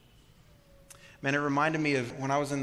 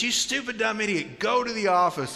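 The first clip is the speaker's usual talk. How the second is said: shrill mock scolding, pitched way up; exasperated teacher; 8 semitones higher, 4 dB louder than usual. Teacher scolding a kid on the floor, mock outraged scold. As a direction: play the fed-up teacher snapping at the boy. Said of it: "She just goes".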